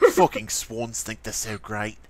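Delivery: lightly mocked grumble, low; low put-on grouch; mocking imitation. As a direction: imitate a friend grumbling to himself. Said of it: "Why do I sound like that?".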